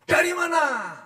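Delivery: "Dari mana?" asked high, falling on "mana"; demanding wife's high question; mock nagging wife asking "Dari mana?" up high; high-pitched question. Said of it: "Nadanya tinggi".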